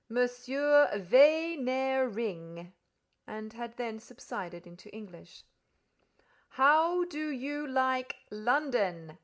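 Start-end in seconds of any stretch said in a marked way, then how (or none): 0.1-2.7 put-on slow, loud, explaining to a child; condescendingly explanatory. A narrator's reading. Podsnap introducing the Frenchman, slow and condescending. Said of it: "in a condescendingly explanatory manner". 6.5-9.2 slow, loud, overly clear; talking to a deaf child. A narrator's reading. Host quizzing the foreign guest, slow and loud. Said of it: "as if he were administering something".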